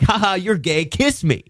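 a hint of a jeering bully; mocking bully. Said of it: "like the kind of".

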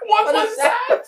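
squeaky high, laughing; laughing disbelief. Real, not acted. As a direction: laugh out the question. Stunned by the country outro.

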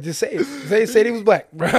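laughing, egging him on; teasing the kid to just say it, laughing; laughing push. Retold or imitated. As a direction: say it while cracking up. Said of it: "I'm like".

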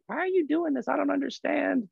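squeaky worried mom voice, played for laughs; imitating mom. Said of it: "my mom was like".

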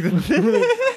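high-pitched, slowing as laughter takes over; laughing. Real, not acted. Co-host cracking up at the movie theory, laughing through words. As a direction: break up laughing mid-sentence.

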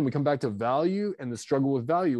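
normal talking voice

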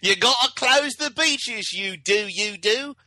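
high, slow, hammed-up British stage actor; mock British stage accent. Mock British stage voice pleading to close the beaches. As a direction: camp it up like a West End Jaws. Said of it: "a British stage version of Jaws".

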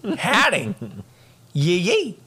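high, hammed-up cowboy accent; mock cowboy accent; cowboy whooping. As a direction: play at a Southern yee-haw.

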